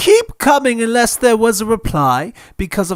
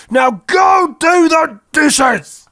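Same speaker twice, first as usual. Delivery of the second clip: angry order; 8 semitones higher, slower than usual. Acted. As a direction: shout the order down at him. Uncle ordering Harry about.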